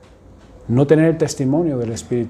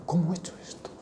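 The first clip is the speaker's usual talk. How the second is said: much softer, almost breathed away; broken murmur; 3 semitones higher, 14 dB quieter, faster than usual. Acted. Peter running off in tears, murmuring to himself, much softer. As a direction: let the question die under your breath.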